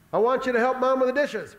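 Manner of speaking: loud, high dad-style order; parental order. Acted. Dad telling his child to help Mom, loud order. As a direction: call it out like a dad assigning chores.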